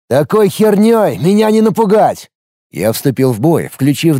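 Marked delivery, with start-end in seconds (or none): 0.1-2.3 defiant shout. Acted. Hero taunts in battle.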